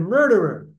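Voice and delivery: loud one-word accusation; acted yell. Prophet accusing a man in shul, mock yelling. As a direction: call it out as a charge. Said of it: "he yells at him".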